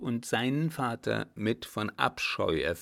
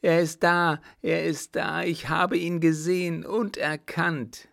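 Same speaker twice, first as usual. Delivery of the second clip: high, loud, frantic lament; wailing madman; 7 semitones higher, 5 dB louder than usual. Acted. Deranged baron crying out from the pillows, wailing. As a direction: wail it out in a raised voice.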